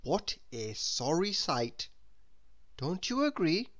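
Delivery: plain reading voice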